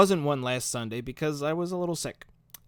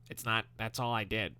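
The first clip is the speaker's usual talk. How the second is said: Squidward voice; 4 semitones lower, 8 dB quieter than usual. Retold or imitated. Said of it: "that's a really good Squidward impression".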